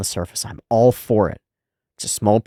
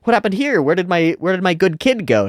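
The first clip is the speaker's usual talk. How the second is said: bewildered parent; 9 semitones higher, 5 dB louder than usual. Retold or imitated. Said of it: "You're like".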